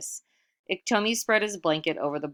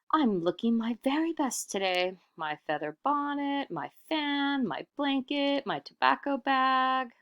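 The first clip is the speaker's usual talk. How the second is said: lightly voiced show-off, a bit higher; vain Iktomi; 9 semitones higher than usual. Iktomi admires his own finery, lightly voiced higher. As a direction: preen over each item.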